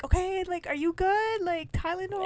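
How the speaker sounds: high, worried fussing voice; lightly mocking, high pitched concern; fussy relatives. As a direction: mimic the family hovering anxiously. Family fussing over sick sister.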